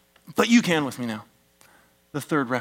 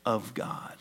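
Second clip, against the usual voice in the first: hushed reverent whisper; 5 semitones lower, 11 dB quieter than usual. Real, not acted. Sermon climax, dropped to hush.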